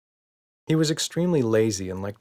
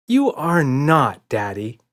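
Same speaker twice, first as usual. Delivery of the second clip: put-on high, loud, slow playful child's voice; teasing little girl; 5 semitones higher, 6 dB louder, slower than usual. A narrator's reading. Emma doubts Daddy is dangerous, teasing child. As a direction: tease him like a kid.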